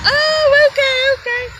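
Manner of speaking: high-pitched mock realization, tailing off; waitress finally understanding. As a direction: imitate a waitress suddenly getting it. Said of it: "And she was like".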